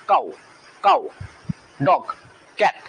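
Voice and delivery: "কাউ", "ডগ", "cat" each in one go, spaced apart; one syllable each. Shown as a demonstration. Teacher showing students single syllables, "কাউ", "ডগ", "cat" each unbroken. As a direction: give "কাউ", "ডগ", "cat" one short beat each, pausing between. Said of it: "একবার থামতে হচ্ছে".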